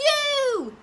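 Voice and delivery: put-on very high, loud, delighted child; little one gives mum toys, high and loud; beaming. A narrator's reading. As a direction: shout it like a proud toddler. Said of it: "he beamed".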